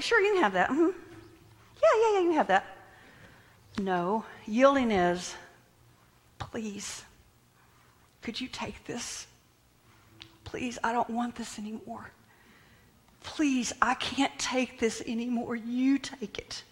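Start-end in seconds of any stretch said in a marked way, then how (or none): 0.0-2.6 higher, offhand yeah-sure voice; breezy mock agreement. Preacher showing what yielding is not, breezy mock agreement. Acts out offhand, false giving. 6.4-16.6 soft, half-whispered plea; hushed pleading. Acted. Preacher acting out yielding to God, hushed pleading.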